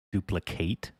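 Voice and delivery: DUPLICATE with a full "kate" at the end; verb pronunciation. Shown as a demonstration. Streamer puzzling over a word, Duplicate ending in "kate". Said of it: "duplicate is the verb".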